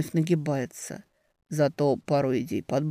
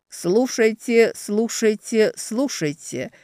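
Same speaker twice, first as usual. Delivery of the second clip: herald's call; 4 semitones higher, slower than usual. Acted. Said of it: "Голос у Герольда с лёгкостью перекрывает рога и толпу".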